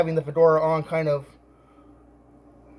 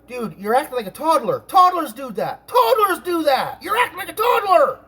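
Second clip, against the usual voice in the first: retold tirade climbing higher and higher; building angry yell; 9 semitones higher, 3 dB louder than usual. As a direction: re-enact a scolding that rises into a yell. Acted shouting match, replying to mockery.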